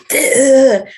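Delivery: high, loud, mocking duh. Impatient woman mocking slow healers, high loud duh. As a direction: toss out a cartoonish duh.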